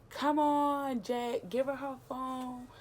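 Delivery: high, slow urging; friend pleading. Retold or imitated. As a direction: plead with him to hand it over. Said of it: "she just like".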